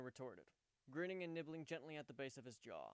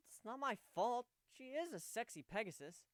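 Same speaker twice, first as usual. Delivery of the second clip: higher, louder embarrassed defense; flustered outburst; 10 semitones higher, 6 dB louder than usual. Acted. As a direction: blurt it out defensively.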